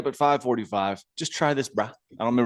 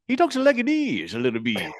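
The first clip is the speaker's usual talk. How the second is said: Little Dan's toothless Stone Cold; 3 semitones higher than usual. Retold or imitated. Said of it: "his toothless Stone Cold kinda talks like this".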